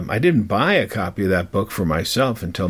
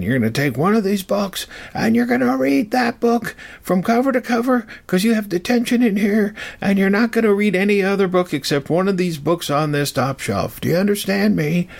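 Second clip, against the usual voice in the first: retold angry yelling; 12 semitones higher than usual. The man in the library giving the boy detention, angry mock yelling. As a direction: bark out the detention order as he yelled it. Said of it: "yelling in the library".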